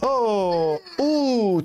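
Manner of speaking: high mock "oh"; imitated assistant moan. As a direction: coo an exaggerated oh like the assistant. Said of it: "Tu vois, elle fait, oh". (petit « oh » aigu imité)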